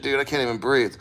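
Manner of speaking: ordinary talk, nothing marked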